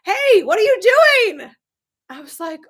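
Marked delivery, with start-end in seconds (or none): 0.0-1.5 high, loud, slow mock call up the stairs; imitating husband yelling. Husband calling up the stairs to her, as a loud mock yell. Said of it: "my husband's yelling at the bottom of the stairs".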